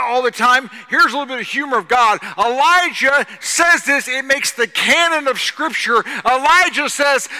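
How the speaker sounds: shouted, high-pitched taunting sermon; exuberant, loud pulpit oratory; full-force preaching. Acted. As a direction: shout it to the back row. Builds to shouted punch line.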